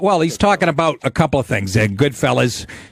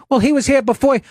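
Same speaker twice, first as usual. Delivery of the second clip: put-on higher nervous kid voice; bakery kid; 10 semitones higher than usual. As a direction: play the timid clerk. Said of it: "the kid goes".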